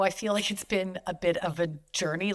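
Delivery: ordinary talk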